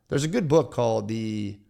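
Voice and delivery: ordinary talk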